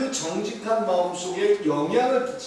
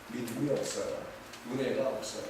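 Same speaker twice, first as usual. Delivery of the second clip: lower, softer voice of admitting doubt; subdued confession; 8 semitones lower, 10 dB quieter than usual. Acted. Believer admitting to God a lack of faith, subdued. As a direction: confess it quietly, voice dropped.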